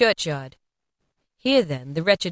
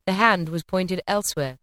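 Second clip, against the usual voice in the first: usual speaking voice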